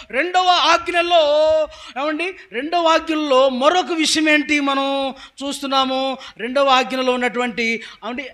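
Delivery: ringing, emphatic preacher; full-force preaching. Real, not acted. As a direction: proclaim the commandments to the congregation.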